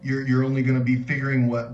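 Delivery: usual speaking voice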